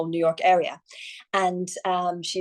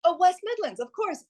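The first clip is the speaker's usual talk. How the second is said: sudden realisation; 10 semitones higher than usual. Real, not acted.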